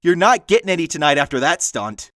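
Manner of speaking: mock yell. Haunt worker teasing a boyfriend who ran off, mock yell. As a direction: call it out after the fleeing boyfriend. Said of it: "and then yell after him".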